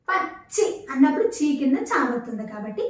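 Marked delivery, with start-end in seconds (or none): 0.1-0.7 పచ్చి split by a held stop on చ్చ; pressed double చ. Shown as a demonstration. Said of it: "ఒత్తి పలుకుతున్నాం".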